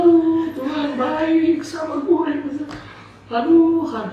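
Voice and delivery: loud, high mock wail; drawn-out imitation of crying; tearful gratitude. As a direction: wail it slowly, overcome with thanks. Acting the sibling's tearful gratitude.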